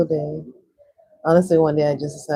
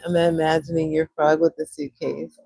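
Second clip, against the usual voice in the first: ordinary talk, nothing marked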